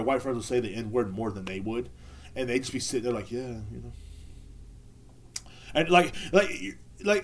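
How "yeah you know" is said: much softer, small put-on voice; imitated meek agreement. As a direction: toss it off quietly, mock resigned. Imitates friends sitting there resigned.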